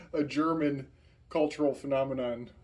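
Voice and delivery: ordinary talk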